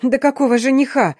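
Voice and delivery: high, exasperated squeal; shrieking outburst. A narrator's reading. Heroine jumping up at talk of a groom, squealing. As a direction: burst out in indignant disbelief. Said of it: "взвизгнула я".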